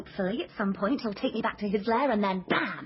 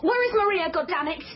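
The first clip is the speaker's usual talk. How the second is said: angry yell; 8 semitones higher, 4 dB louder than usual. Acted. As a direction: shout it higher and louder. Detective yelling at suspect.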